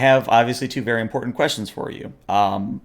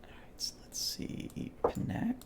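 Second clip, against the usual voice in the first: muttered to self, unvoiced; whispering; 15 dB quieter than usual. Real, not acted. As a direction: whisper it under your breath. GM muttering while checking notes.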